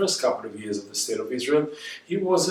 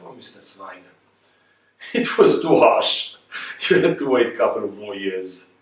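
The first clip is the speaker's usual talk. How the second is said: rueful laughing aside; 3 semitones lower, 4 dB louder than usual. Pretend. Telling Zweig to himself, laughing ruefully. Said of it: "said to myself".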